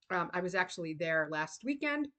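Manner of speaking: ordinary talk, nothing marked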